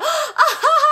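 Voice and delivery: high-pitched 'ah ha ha' cackle; put-on high laugh; mock crowd laugh. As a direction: imitate a room bursting out laughing. Everybody laughing, acted out.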